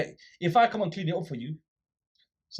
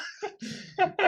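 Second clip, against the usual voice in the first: laugh. Real, not acted. Laughing at the kid's mic call.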